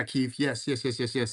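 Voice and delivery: normal talking voice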